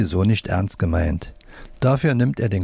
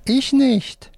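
very high puppet squeak; left-hand puppet; much slower than usual. A narrator's reading. Left hand objects to the right hand, squeaky puppet voice. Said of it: "Wolfram mit der linken Hand".

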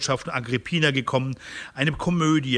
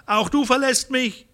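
high-pitched reproachful cry; calling after someone; 10 semitones higher than usual. A narrator's reading. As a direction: call it after a departing servant, pitched right up. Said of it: "rief ihr Agrippina nach".

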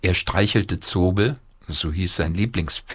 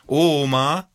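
put-on raised childish call; 7 semitones higher than usual. A narrator's reading. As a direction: call out to grandma, high. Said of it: "rief Paul".

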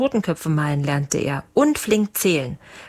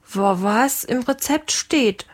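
higher, slow, stuttering reply; nervous stammer; 5 semitones higher, slower than usual. A narrator's reading. Cook answers the furious captain, nervously stammering. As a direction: stumble on the first word, timidly pitched up. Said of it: "stotterte der Lange".

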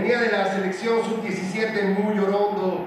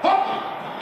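high, loud touchline yell; shouted orders; 13 semitones higher, 4 dB louder, much slower than usual. Acted. Football coach calling to his player mid-match, shouting. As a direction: bellow it across the pitch.

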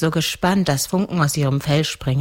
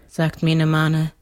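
usual speaking voice

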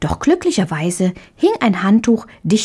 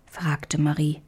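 plain reading voice

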